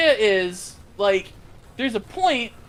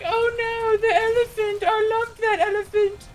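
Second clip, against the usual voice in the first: much higher, whiny mock grief; a little girl wailing over her toy; mock sad daughter; 12 semitones higher than usual. Daughter mourning her elephant, high mock whine. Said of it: "And she's like".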